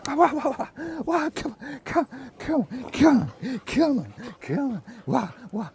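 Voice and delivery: excited coaxing. Real, not acted. Calling dog to him.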